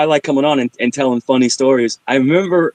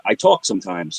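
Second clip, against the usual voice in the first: ordinary talk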